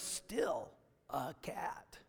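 whisper. Acted. Punchline about the dressed-up cat.

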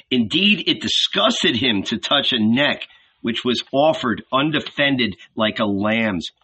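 plain reading voice

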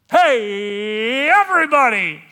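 high, drawn-out full shout; yelling out. Acted. Unmasked man to the whole room, yelling out. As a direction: holler to a whole crowd, stretching the words. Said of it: "I yell out".

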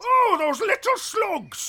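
petty indignation. Acted. Emperor cursing the raiders.

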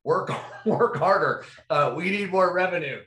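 hammed-up insistent manager; mock demanding boss. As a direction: drag out the demands like a pushy executive. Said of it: "it was like".

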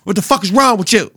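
heated imitated shout at the opponent; Bobby Portis mock yell. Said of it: "'Cause Bobby said".

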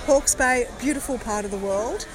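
usual speaking voice

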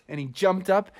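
ordinary delivery, nothing marked

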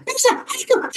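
very high, squealed tsunami alert; imitated terrified screaming. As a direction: imitate a woman screaming warnings in terror. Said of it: "she was screaming".

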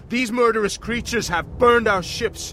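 high, loud battle rally; rallying shout. Acted. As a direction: rouse the troops to slaughter. Rallies soldiers to fight.

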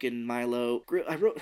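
ordinary talk

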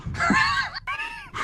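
a high, shrieking burst of laughter. Real, not acted. GM shrieks at the kill.

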